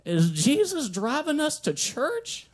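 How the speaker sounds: put-on high, slow old-lady voice; imitating a hopeful grandma, slow and high; sweet grandma. Grandmother asking from the car seat, high and slow. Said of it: "She goes".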